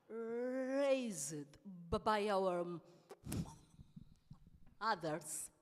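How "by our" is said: ordinary talk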